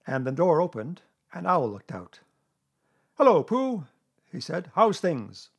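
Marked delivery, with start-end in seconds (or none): 3.2-3.9 loud, high-pitched Owl; Owl's greeting. A narrator's reading. Owl greeting Pooh at the door, loud. 4.7-5.5 loud, high-pitched Owl; Owl's greeting. A narrator's reading. Owl greeting Pooh at the door, loud.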